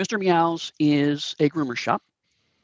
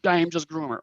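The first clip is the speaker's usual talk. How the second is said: thick, obviously fake Korat accent, pitched up; louder, higher hammy Korat accent; 6 semitones higher, 7 dB louder than usual. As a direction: play a bad put-on Korat accent. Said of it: "very thick, um, Korat accent".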